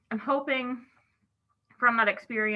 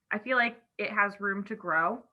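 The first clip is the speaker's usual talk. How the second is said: talking around a cough drop; cough drop in mouth; 3 dB louder than usual. Real, not acted. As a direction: speak with a lozenge in your mouth. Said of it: "I have a cough drop in my mouth".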